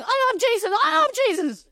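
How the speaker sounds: high, overexcited repetition; acted-out giddy enthusiasm; excited echo. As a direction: chant it back high and thrilled. Acts their excited echo.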